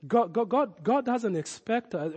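ordinary delivery

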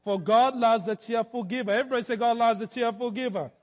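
normal talking voice

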